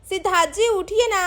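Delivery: normal talking voice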